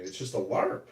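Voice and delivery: ordinary delivery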